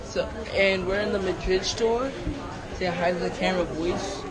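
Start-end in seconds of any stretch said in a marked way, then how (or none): none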